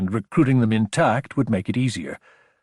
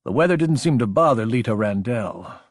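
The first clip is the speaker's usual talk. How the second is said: ordinary delivery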